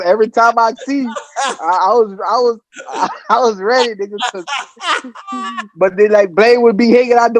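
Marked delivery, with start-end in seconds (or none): none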